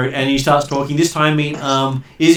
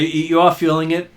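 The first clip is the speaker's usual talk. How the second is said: usual speaking voice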